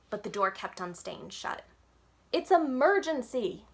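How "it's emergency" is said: slow, high, loud plea; drawn-out urgent shout. A narrator's reading. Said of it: "I shouted".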